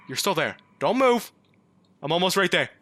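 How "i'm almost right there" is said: ordinary talk, nothing marked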